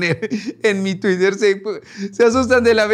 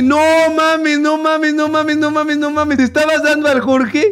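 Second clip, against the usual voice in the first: high-pitched, rapid repeated exclamations; hammed-up incredulity, pitched high; acted disbelief; 7 semitones higher, 4 dB louder than usual. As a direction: act out a friend's shocked reply. Reads friend's shocked message aloud.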